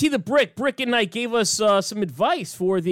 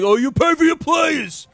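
mocking heckler; 6 semitones higher, 4 dB louder than usual.

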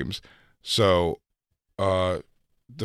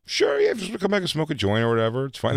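mock eager agreement; 9 semitones higher, faster than usual. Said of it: "I go".

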